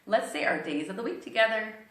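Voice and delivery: ordinary talk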